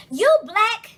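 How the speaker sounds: slow, loud mock preaching; mocking a kid's preachy slow lecture. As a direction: drag out the words like a pompous kid lecturing.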